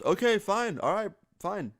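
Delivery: high-pitched, grudging concession; imitating a friend reluctantly relenting; exasperated giving in. Brother insists, Logan gives in.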